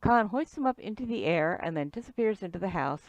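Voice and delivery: ordinary talk, nothing marked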